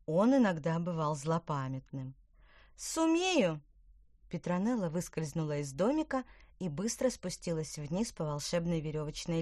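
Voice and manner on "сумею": excited. Acted. Character exclaims confidently.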